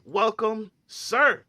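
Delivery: big, high-pitched stage introduction; hype announcer intro. Acted. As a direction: bring the guest on like an MC. Host announces guest on stage.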